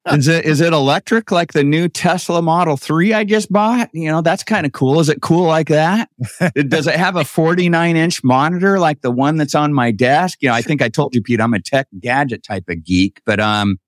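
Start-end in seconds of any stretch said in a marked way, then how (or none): 0.1-10.4 higher-pitched sceptical quizzing; retold mock questioning. Gadget geek quizzing his brother about an old boat, sceptical. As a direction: pepper him with mock-hopeful questions.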